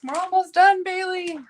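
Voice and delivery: high, loud singsong call to a pet. Real, not acted. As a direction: call across to the dog, pitched right up.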